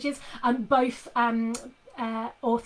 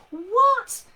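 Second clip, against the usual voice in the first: sharp high, loud what; incredulous; 12 semitones higher, 10 dB louder than usual. Retold or imitated. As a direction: blurt it out in disbelief. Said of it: "in a kind of incredulous voice".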